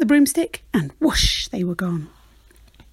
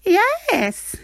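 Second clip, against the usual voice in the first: high witch exclaiming; a quick welcoming yes; the witch welcoming the bird aboard; witch crying yes; 10 semitones higher than usual. Acted. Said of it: "cried the witch".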